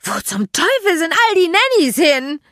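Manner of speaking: high, loud, furious screech; shrieking. A narrator's reading. Magda hunting for the missing nannies, shrieking. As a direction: screech it in exasperation. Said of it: "kreischte Magda".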